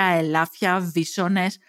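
usual speaking voice

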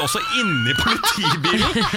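high, laughing exclamation; half-shouted through laughter; laughing outburst. Real, not acted. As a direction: crack up while telling it.

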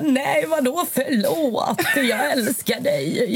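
high, drawn-out tearful protest, imitated; Josef crying. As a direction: wail it lovingly like an upset child. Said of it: "Så bara bröt han ihop".

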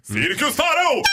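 announcer call. Real, not acted. Host shouting the show name to the studio. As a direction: announce it like a circus ringmaster. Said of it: "host's playful announcement".

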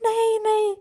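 high, drawn-out panicked plea; hammed-up alarm, pitched high; mock panic. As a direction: play a partner suddenly panicking. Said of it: "han får panik".

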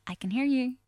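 sweetly quiet complaint; nice but pointed. Shown as a demonstration. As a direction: gently let them know it bothers you. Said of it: "if I say it in a nice way".

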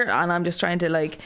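normal talking voice

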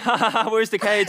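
high, laughing taunt; mocking laugh. Retelling the girls teasing him on the boat, laughing. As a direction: laugh through the quote as a mock jeer.

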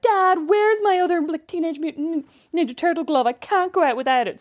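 high, stretched-out mock kid whine; whining child. Mum imitating kids searching for a glove, whining. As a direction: imitate a child complaining to Dad. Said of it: "And they're like".

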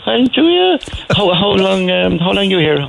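high, delighted Cork woman quizzing; surprised Cork woman. Retold or imitated. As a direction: ask it astonished, like a chatty Cork woman. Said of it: "So she said".

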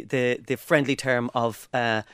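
ordinary delivery

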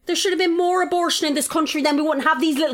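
high-pitched comic tirade; indignant rant. Retold or imitated. Retold rant about the teenagers.

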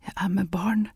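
woman whispering her secret to Kristen. A narrator's reading. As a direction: whisper it as if ashamed to say it aloud. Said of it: "hvisket hun".

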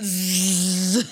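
long, low, droning buzz; imitated vibration buzz. Imitating toy buzzing away.

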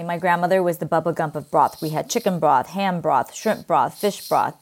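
ordinary talk, nothing marked

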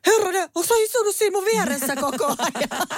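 high-pitched startled exclamation; startled outburst. Retold or imitated. Parent finding the child beside them all along, high startled outburst. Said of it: "Sit mä oon silleen".

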